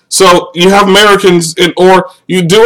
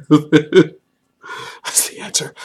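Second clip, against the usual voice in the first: very slow, voiceless repeat after a laugh; soft, breathless amused echo; laughing whisper; 9 dB quieter, much slower than usual. Real, not acted. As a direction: wheeze it out through laughter.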